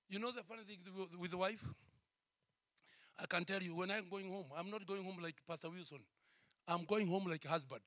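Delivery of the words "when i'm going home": ordinary talk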